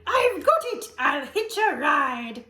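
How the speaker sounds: high, loud sudden idea; excited cry. Acted. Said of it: "then cried".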